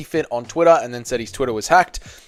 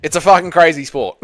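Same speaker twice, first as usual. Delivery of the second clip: usual speaking voice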